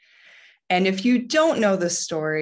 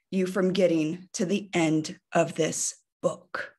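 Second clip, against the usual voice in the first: ordinary talk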